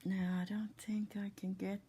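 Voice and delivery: whispered aside to herself; whispering. Real, not acted. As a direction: mutter it under your breath. Muttering to herself while filming.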